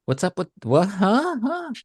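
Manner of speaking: mock confusion, pitch climbing sharply; rising, incredulous huhs; baffled. Acting out a baffled viewer.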